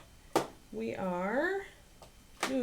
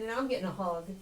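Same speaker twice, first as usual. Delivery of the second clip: usual speaking voice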